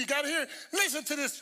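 ordinary delivery

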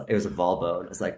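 ordinary delivery

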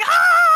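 a sudden high, loud "Ah!" of shock; excited scream. Retold or imitated. Fan screaming at a shocking TV moment, loud high shriek. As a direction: let out a short, shrieking yelp of disbelief. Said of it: "I was scream".

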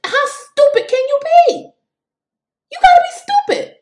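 exasperated outcry. Real, not acted. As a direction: cry out in disbelief at how stupid it is. Exasperated outburst at critics.

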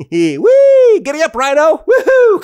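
high, loud joyful cheering; gleeful whoops. Pretend. Host riding the rhino, whooping with glee. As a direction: whoop like a kid on a ride.

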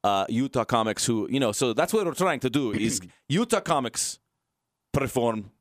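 put-on light comic Russian accent, laughing at the end; mock Russian accent. Host struggling to pitch the show in mock Russian accent. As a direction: keep up a thin Russian accent. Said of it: "in Russian accent".

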